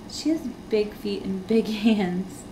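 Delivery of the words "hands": ordinary talk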